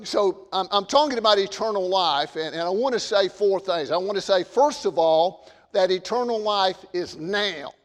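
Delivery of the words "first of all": ordinary talk, nothing marked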